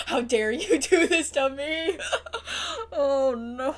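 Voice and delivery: loud laughing cry; laughing through tears. Real, not acted. Viewer blaming the writer, loud laughing sobs. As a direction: rage at the writer while half laughing.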